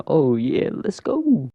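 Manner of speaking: high, drawn-out cheer; mock celebration. Player finding the exit, high mock cheer. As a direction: cheer lightly, climbing high on the end.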